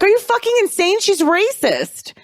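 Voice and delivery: high mock outrage, stretching the last words; outraged husband. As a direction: act out a husband's appalled disbelief. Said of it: "Her husband's like".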